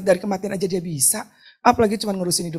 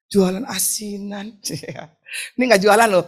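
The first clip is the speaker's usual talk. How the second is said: ordinary delivery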